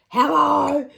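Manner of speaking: high, loud, eager mock greeting; imitated excited yell. Man delighted to see the dancer, excited holler. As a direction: holler it like an overexcited admirer. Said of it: "you could tell he was excited".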